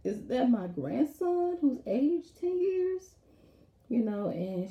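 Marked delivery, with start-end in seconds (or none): 0.0-3.1 high, slow, disbelieving gasp of a question; mock shock at a grown-up grandson; shocked grandmother. Grandmother shocked her grandson aged overnight, high and slow. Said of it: "She was like".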